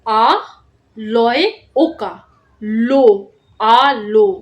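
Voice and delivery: letter by letter, very slow, then the whole word; spelling out slowly. Shown as a demonstration. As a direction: spell it out for young pupils. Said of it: "তোমরা শুনে নাও মন দিয়ে আমি কিভাবে বলছি".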